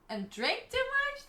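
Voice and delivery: can't believe it; sharply rising incredulous question. Real, not acted. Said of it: "That doesn't sound like Germans".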